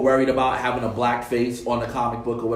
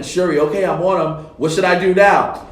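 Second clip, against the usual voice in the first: lightly acted pilot, raised and louder; pilot asking; 5 semitones higher, 6 dB louder than usual. Pilot asks Shuri for instructions while flying, urgent call.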